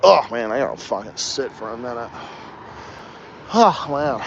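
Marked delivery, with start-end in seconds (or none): none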